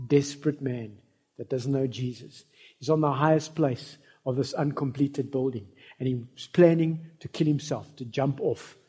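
ordinary delivery

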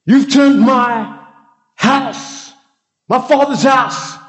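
high, furious shouting at the temple traders; Jesus's angry shout. Shown as a demonstration. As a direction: roar at them to get out of the house of prayer. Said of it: "I'm gonna demonstrate the one time where he exhibited this kind of behavior".

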